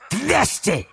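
high, curt snapping bid; sharp snap. Acted. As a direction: snap it like a breaking twig. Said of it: "произнёс он так резко".

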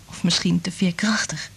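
soft whisper. Acted. Liz corrects Frank in a whisper. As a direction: let it slip out quietly.

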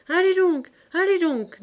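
high, loud, scornful send-off; scornful dismissal. Acted. Lisa sending her rival away, scornful dismissal.

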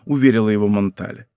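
usual speaking voice

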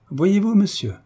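put-on cheerful young woman teasing her dance partner, gaily. A narrator's reading. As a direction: tease him cheerfully, voice leaping up. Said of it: "reprit gaiement Ernestine".

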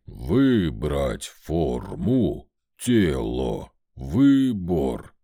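low, slow stony golem voice; rumbling golem. Acted. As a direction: rumble each word like grinding rock.

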